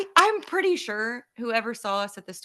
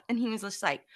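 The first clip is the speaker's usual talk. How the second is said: ordinary delivery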